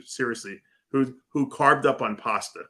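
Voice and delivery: usual speaking voice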